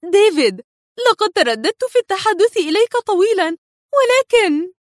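an agitated wife on the phone; wife urgently calls her husband, nervous. A narrator's reading. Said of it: "وهي تقول في عصبية".

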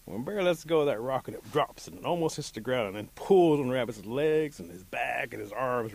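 usual speaking voice

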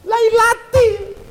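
high, slow "lailatin"; high drawn-out recited "lailatin"; recited word. Shown as a demonstration. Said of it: "berarti setelah fi pasti lay".

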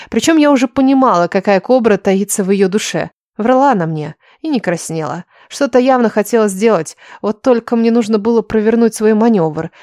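plain reading voice

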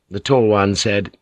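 usual speaking voice

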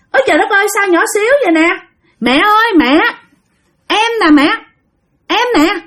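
high-pitched voice of a possessing spirit; possessed aunt's changed voice. Acted. Spirit in aunt Năm remarks on the tiny child, changed high voice. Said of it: "cái giọng của dì Năm thay đổi liền".

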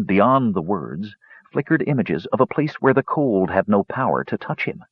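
plain reading voice

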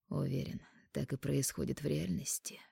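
low, breathy whisper. Acted. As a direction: hush it out ominously. Анхель answers ominously.

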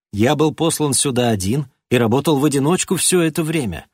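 usual speaking voice

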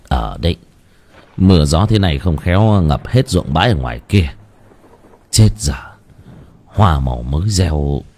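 put-on low old man fretting about the fields; worried old husband. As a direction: grumble about the flood, deep. Ông chồng lo ruộng bãi. (giọng ông lão trầm, lo lắng)

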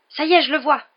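high, loud cry of discovery; excited exclamation. A narrator's reading. Boy spotting the train from above, exclaiming. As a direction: call out a sighting. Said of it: "s'exclama Harry".

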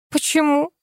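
high-pitched surprised question. A narrator's reading. Viola baffled that sobriety is bad, surprised. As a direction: ask in wide-eyed puzzlement. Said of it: "удивилась я".

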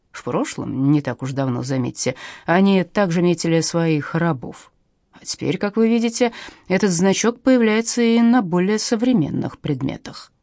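plain reading voice

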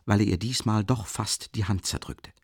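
ordinary delivery, nothing marked

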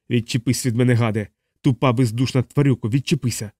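usual speaking voice

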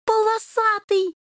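high, joyful kitten cry. A narrator's reading. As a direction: cry out in delight at a friend. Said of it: "радостно вскрикнул Рыжий". (радостный возглас котёнка)

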